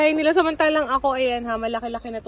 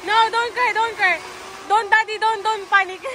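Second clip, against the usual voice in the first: higher, pressing plea not to cry; head off panic with quick repeated pleas; real anxious comforting; urgent reassurance; 8 semitones higher than usual. Real, not acted. Urging frightened children not to cry.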